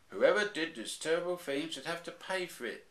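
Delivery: high, mildly indignant little cub; a hint of a cross child bear. Baby Bear demanding someone pays, mildly indignant. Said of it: "said Baby Bear indignantly".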